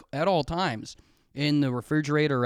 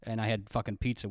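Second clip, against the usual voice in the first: usual speaking voice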